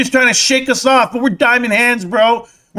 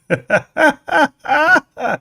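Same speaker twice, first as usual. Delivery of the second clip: mocking laugh; 6 semitones higher than usual. Streamer taunting AMC holders with a fake cackle. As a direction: cackle at them like a cartoon villain. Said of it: "are those real laughs".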